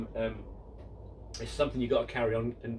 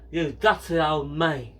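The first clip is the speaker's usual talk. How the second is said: slow, louder put-on Steptoe whine; Harold Steptoe impression; 6 dB louder than usual. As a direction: sneer at the old man like Harold Steptoe. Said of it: "Harold Steptoe goes to his father".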